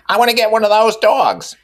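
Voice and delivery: high, eager Mrs. Wilson voice; earnest. A narrator's reading. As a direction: pitch it up and plead sincerely. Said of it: "she said earnestly".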